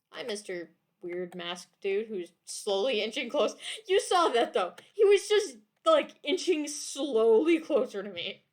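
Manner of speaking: ordinary talk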